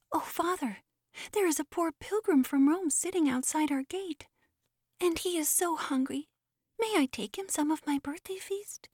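put-on a small boy's high voice begging his father; high and eager, asking a favour; pleading little boy. A narrator's reading.

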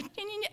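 soft, high little nya-nya; imitated shrew noise. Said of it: "I hear this".

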